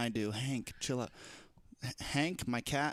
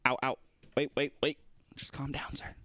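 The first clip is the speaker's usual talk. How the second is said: ordinary talk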